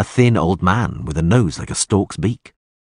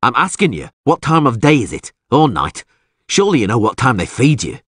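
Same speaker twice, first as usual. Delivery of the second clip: raised, impatient questioning; heated interrogation of cellmates; irritated demand; 5 semitones higher, 4 dB louder than usual. Acted. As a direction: press them crossly for an answer. Presses prisoners for an answer.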